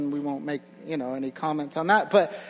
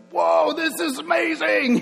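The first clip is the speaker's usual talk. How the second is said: high, loud, drawn-out shout of delight; imitating a little boy thrilled by chocolate; toddler's amazement; 11 semitones higher, 7 dB louder than usual. Toddler son tasting chocolate.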